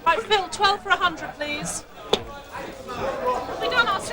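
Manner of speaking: high, pressing order call; trading floor shouting. Real, not acted. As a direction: bark the order over the floor noise. Trader calling an order in the pit.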